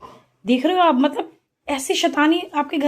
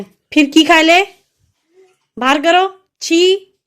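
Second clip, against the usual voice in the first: sharp, louder, higher scold with a disgusted छी; 5 semitones higher, 7 dB louder, slower than usual. Real, not acted. Mother scolding toddler for picking things up, disgusted scold. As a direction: stop her putting things in her mouth.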